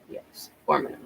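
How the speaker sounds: "four minimum" whispered softly under breath; whispered aside. Shown as a demonstration. Repeats the minimum for emphasis.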